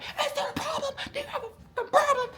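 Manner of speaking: very high, mocking drill sergeant voice; confrontational drill sergeant. Drill sergeant confronts staring trainee, shrieky mock challenge. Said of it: "she just going crazy".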